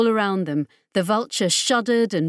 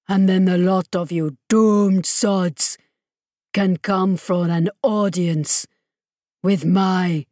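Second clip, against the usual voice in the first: menacing villain; 3 dB louder than usual. Acted. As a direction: threaten them with cold relish. Mesmond threatens the crew.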